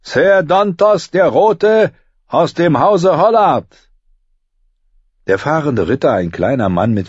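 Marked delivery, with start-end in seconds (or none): 0.0-3.9 high, slow ringing herald's call; royal herald. A narrator's reading. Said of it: "rief der Herold".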